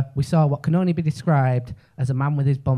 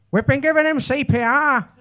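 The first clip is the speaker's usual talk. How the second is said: smackhead voice; 9 semitones higher than usual. Retold or imitated. Voicing the smackhead.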